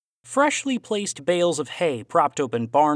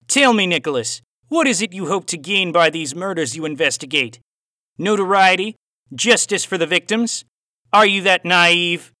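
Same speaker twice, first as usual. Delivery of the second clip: louder, raised Aussie voice with a cowboy twang; Aussie cowboy accent; 5 semitones higher, 6 dB louder than usual. A narrator's reading. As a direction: play the villain Aussie, hint of cowboy twang. Villain's dialogue in story.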